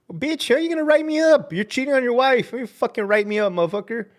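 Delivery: sharp and high, spitting the insult; furious comeback; heated retort. Real, not acted. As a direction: hit back at the boss, high and hard. Ranting at administrators.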